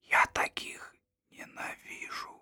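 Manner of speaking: hushed whisper, slow; leaning in to whisper. Acted. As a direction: whisper it like a secret. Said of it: "Он перешёл на шёпот".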